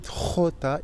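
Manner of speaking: "J" as khota, from the throat; guttural Spanish jota. Shown as a demonstration. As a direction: rasp the start of "J" as in khota. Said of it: "elle se prononce avec la gorge".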